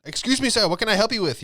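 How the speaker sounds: high, loud mock customer-service voice; mock polite clerk. Comic doing a bodega clerk, loud and high. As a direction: ham up an overly polite shopkeeper greeting.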